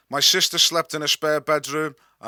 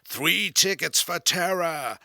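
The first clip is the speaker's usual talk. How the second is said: slow, higher, hammed-up ghost-train voice; comedic spooky voice; 5 semitones higher, slower than usual. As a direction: spook the customers playfully. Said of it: "he said in a comedic voice".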